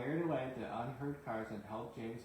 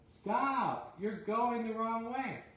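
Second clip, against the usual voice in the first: higher, louder warning shout; urgent shout; 10 semitones higher, 4 dB louder, slower than usual. Acted. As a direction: call out to stop the engine going the wrong way. Driver shouts at Percy.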